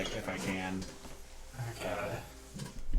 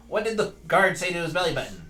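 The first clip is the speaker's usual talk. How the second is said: higher, louder, fast puppet setup; Leeward puppet voice; 7 semitones higher, 6 dB louder, faster than usual. Acted. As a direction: rattle off the joke as the puppet. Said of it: "I'll pop the puppet back up and go".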